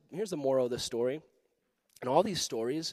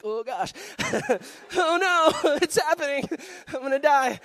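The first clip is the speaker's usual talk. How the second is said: laughing mock panic; 14 semitones higher, 8 dB louder than usual.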